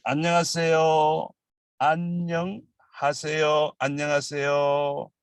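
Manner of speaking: 안녕하세요 split as 안녕, pause, 하세요; broken into parts. Shown as a demonstration. Teacher helping learners understand the pronunciation, broken into parts. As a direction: say 안녕하세요 in two careful, separated chunks. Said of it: "understanding the pronunciation".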